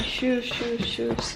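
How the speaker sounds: put-on soft, breathy toddler asking for shoes; imitating toddler. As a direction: whisper it like a tiny kid begging.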